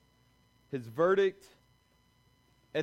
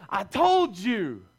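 slow, lightly voiced I-told-you-so; smug God; 4 dB louder, slower than usual. God gloating at Noah's family after the flood, mock smug. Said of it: "he says".

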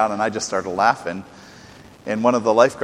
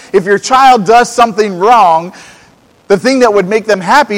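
full-force preaching; 9 semitones higher, 8 dB louder than usual. Acted. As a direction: drive the point home from the pulpit. Preaching climax to the congregation.

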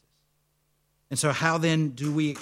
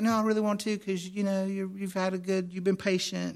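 high-pitched imitation of an insistent clerk; imitated rental clerk; 5 semitones higher than usual. Voicing the female rental clerk.

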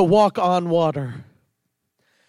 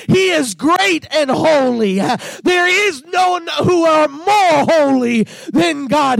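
shouting praise; 4 semitones higher than usual. Retold or imitated. Preacher voicing the angels' true praise, fervent shouting. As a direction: proclaim it at full force. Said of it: "They're trying to say".

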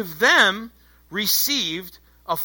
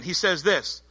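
ordinary talk, nothing marked

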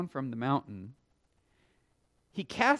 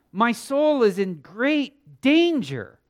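desperate father; 5 semitones higher, 8 dB louder than usual. Acted. Preacher voicing the desperate father.

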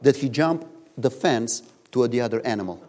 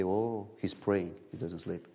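mock hush; 5 semitones lower, 10 dB quieter than usual. People excusing a sleeping man.

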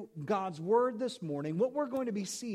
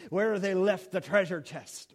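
pirate voice. Pretend. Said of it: "the last time I'm gonna do the pirate voice".

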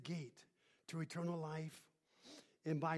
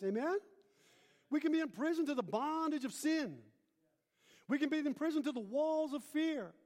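loud, high preaching cadence; rising preacher's call to the congregation; 8 semitones higher, 4 dB louder than usual. Acted. Preacher building to the congregation, loud and high.

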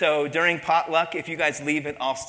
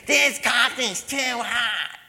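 high, slow, whiny complaint; cranky customer; 6 semitones higher, much slower than usual. Retold or imitated. A fussy restaurant customer whining to her waiter, high and slow. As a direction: drag out the grumble like a fussy diner.

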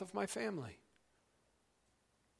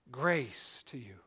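hushed Pauline greeting; whispered greeting; 7 semitones lower, slower than usual. Acted. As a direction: breathe it like a blessing. Paul's greeting said softly.

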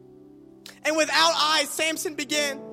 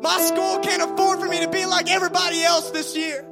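raised, earnest praying voice; fervent prayer; 3 semitones higher than usual. Acted. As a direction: pray it out with conviction. Preacher models a prayer.

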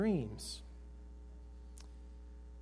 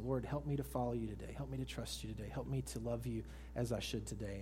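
softer, lower earnest prayer; hushed prayer; 4 semitones lower, 9 dB quieter than usual. Acted. Preacher voicing a quiet prayer to the Lord, softly.

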